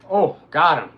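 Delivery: loud burst of triumph; triumphant shout. Real, not acted. Streamer landing a kill in the game, loud triumphant shout. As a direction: celebrate the hit.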